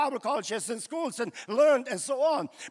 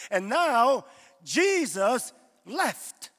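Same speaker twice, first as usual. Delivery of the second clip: slow and weighty; 4 dB louder, much slower than usual. Real, not acted. Preacher slowing down to tell the church Jesus left. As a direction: draw out each word slowly. Slow dramatic sermon statement.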